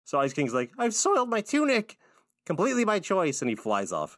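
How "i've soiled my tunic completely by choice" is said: put-on much higher, far-off old wizard squeak; Ice King voice. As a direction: pitch way up into a wobbly old king. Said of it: "You're good at his voice".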